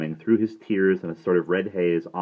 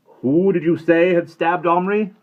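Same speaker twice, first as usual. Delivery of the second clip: stern headmaster; 13 semitones higher, 11 dB louder than usual. A narrator's reading. Headmaster pressing questions.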